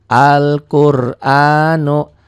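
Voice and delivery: Al-Qur'anu with the l sounded, slow and loud; careful recitation. Shown as a demonstration. Teacher showing pupils a lam that is read. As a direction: chant Al-Qur'anu, lam clear. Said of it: "lamnya dibaca".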